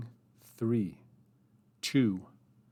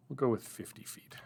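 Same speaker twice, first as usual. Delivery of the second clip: whispered to self; 6 dB quieter than usual. Real, not acted. Typing, deciding aloud.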